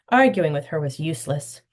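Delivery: plain reading voice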